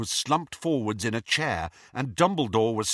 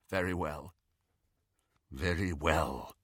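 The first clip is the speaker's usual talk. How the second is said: subdued; 5 semitones lower, 6 dB quieter than usual. A narrator's reading. Snape regaining control.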